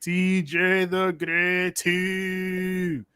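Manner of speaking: very slow stretched name call; drawn-out callout. Acted.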